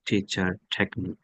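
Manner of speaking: "টিচার" and "টেকনিক" said softly with an aspirated t. Shown as a demonstration. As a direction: lightly puff the t opening "টিচার". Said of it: "এভাবে".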